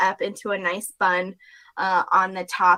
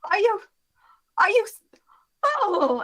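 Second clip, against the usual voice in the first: high, breathless trailing off; high breathless fade; fainting Celia; 12 semitones higher than usual. Acted.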